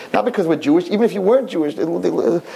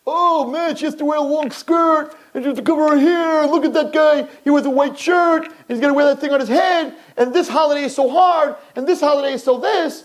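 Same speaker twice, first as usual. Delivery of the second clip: higher, scornful voice of the scoffing world; mocking onlookers; 11 semitones higher than usual. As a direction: sneer at them like a jeering crowd.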